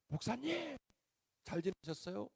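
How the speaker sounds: a lightly voiced happy young man; cheerful deacon. Deacon greets pastor brightly.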